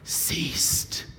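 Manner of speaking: hushed, slow whisper. A narrator's reading. Preacher tells the sea went still, whispered. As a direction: let the calm fall in a whisper. Sudden hush after the climax.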